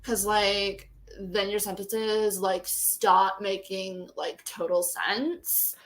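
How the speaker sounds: put-on Valley Girl. Teasing a friend's Valley Girl act, drawling Valley Girl accent. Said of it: "do a really good Valley Girl".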